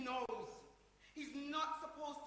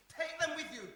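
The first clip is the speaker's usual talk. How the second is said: firm loud order; 4 semitones higher, 8 dB louder than usual. Acted. Repeating order louder.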